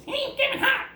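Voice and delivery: Kevin Hart squeaky voice. Retold or imitated. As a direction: squeak it out like a tiny hyped comic. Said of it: "like, a squeaky voice".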